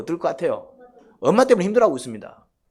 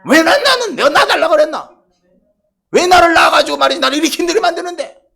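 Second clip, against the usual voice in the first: much higher, louder shouting complaint; kids talking back; 13 semitones higher, 12 dB louder than usual. Retold or imitated. Children shouting back at mother, resentful. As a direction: whine back at mom like a resentful teen. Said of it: "애들이 그럽니다".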